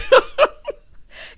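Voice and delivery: loud, high cackling laugh; high burst of laughter. Real, not acted. Host cracking up at the late-night antics, high loud laugh.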